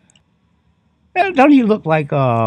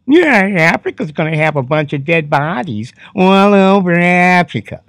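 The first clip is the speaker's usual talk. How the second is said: exaggerated impression of a politician's wife; 5 semitones higher, 5 dB louder than usual.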